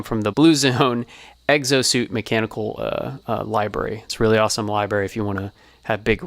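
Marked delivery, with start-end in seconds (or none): none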